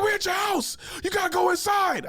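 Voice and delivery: high-pitched urgent imitation; exasperated yell. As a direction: order a drunk friend indoors at the top of your range. Acts out exasperated friend.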